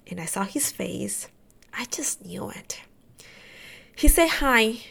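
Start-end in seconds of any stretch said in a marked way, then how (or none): 0.1-2.8 very soft, dropping almost to a whisper; hushed realization. Real, not acted. Patient recalling the doctor's face, hushed realization. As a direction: let the voice sink away, nearly voiceless.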